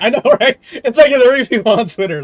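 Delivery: cracking up at how few there were; laughing. Real, not acted. Laughing at tiny boycott.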